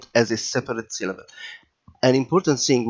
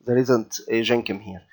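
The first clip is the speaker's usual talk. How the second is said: ordinary delivery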